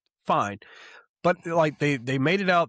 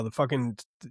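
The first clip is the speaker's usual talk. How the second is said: ordinary talk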